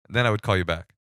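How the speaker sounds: ordinary talk, nothing marked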